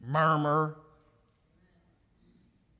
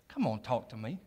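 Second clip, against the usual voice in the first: gentle coaxing God; 4 semitones lower, 6 dB quieter than usual. Retold or imitated. God nudging the listener.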